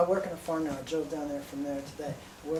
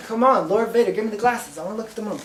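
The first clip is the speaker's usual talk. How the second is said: coaxing louder; 5 semitones higher, 9 dB louder than usual. Retold or imitated. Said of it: "I'm like".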